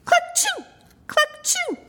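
very high clucking sneeze; sneezing hen. A narrator's reading. Narrator as the hen, high clucking sneeze. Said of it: "She tipped hen's nest".

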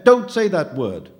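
loud, high, drawn-out shout. A narrator's reading. Boy stops phantom naming his father's death, shouting. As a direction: cut him off with a forceful shout. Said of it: "Luca shouted".

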